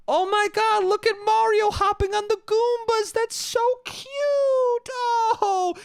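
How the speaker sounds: very high squealing put-on coo; mock gushing fan. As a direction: gush in a high falsetto, mocking the delight. Mocking gushing Mario fans.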